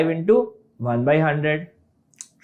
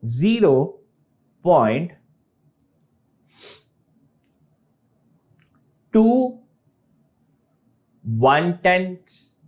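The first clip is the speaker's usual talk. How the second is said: slow, "टू वन टेंथ्स, seven वन hundred, four वन thousand" with long pauses; slow place-value reading; slower than usual. Shown as a demonstration. Teacher slowly showing the place-value reading. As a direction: spell out each place value, drawn out and deliberate. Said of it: "इसका एक और तरीका है जिसको कैसे पढ़ेंगे".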